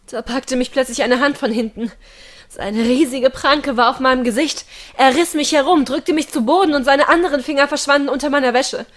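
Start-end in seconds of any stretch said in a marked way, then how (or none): none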